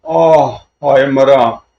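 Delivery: hammed-up cry after a blow; acted wail of pain. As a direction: wail as if badly hit. Villain wails when struck. (दर्द से हाय-हाय करना)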